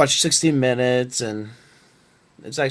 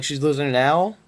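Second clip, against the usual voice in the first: ordinary talk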